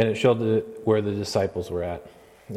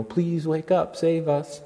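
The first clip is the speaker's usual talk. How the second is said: begging; 7 semitones higher, slower than usual. Retold or imitated. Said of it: "begging him".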